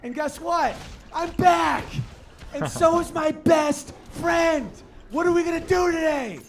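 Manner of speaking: high, loud, building shouts of dog joy; overjoyed dog shouting. Retold or imitated. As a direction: shout like a dog back from the dead. Dog voiced in joke.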